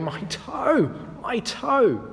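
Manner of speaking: high, hammed-up yelp over a stubbed toe; mock pained whine. Preacher mimics himself after stubbing his toe, mock pained. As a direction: overplay the hurt toe. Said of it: "probably making more drama than you need to".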